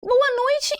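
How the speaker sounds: boa noite sung out high; bright greeting. Real, not acted. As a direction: greet the audience with a lifted voice. Saudação animada ao público. (saudação animada bem aguda)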